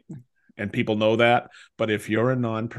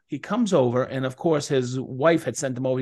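usual speaking voice

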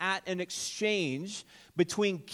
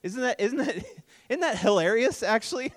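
ordinary talk

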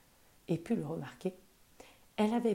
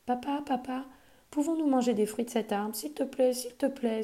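put-on higher, pleading little girl; begging child; 6 semitones higher than usual. A narrator's reading. Polly begs her father to let them eat, pleading child. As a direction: beg your father sweetly. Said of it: "supplia Polly".

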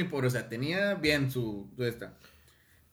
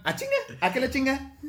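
high, punchy rapper-style call; 11 semitones higher than usual. Retold or imitated. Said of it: "como Santa Fe Clan".

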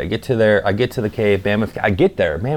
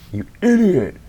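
self-scolding; 10 semitones higher than usual. Retold or imitated. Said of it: "people beat themselves up".

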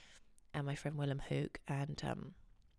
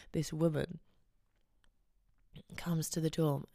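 ordinary talk

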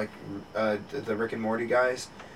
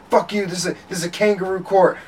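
high, loud imitation of an irate defendant; retold courtroom outburst; mock screaming; 8 semitones higher, 9 dB louder than usual. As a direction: yell it at the judge, hammed-up. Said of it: "screaming at each other".

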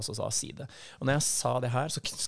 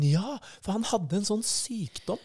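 much higher, slow woman's voice; lightly voiced woman, slower; quoting her; 9 semitones higher than usual. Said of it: "Og da sier hun".

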